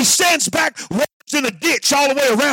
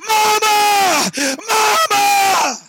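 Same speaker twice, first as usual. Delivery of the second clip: put-on high crying shout of a child; boy crying out; 6 semitones higher, much slower than usual. Revived boy calls out to his grieving mother, crying shout. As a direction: call out for her through tears.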